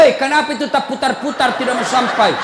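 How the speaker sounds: loud, high annoyed heckle; teriakan jengkel. Retold or imitated. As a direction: imitate an exasperated friend yelling out. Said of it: "Langsung teriak". (teriak jengkel dengan nada tinggi)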